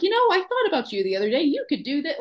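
mother-in-law voice. Retold or imitated. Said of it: "David's mom sees stuff and is like".

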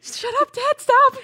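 very high squeal, loud on the last word; mock overwhelmed. Viewer begging the movie dad to stop, high mock wail. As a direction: wail it, hammed up, like it's unbearably touching. Viewer overcome by father's confession.

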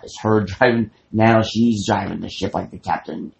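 usual speaking voice

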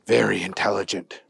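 plain reading voice

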